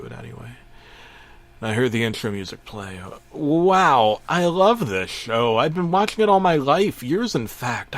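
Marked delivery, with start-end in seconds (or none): 3.3-8.0 high, loud, gushing delight; gushing fan. Acted. Middle-aged fan raving about Jimmy Neutron, gushing. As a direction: gush like an overexcited fan.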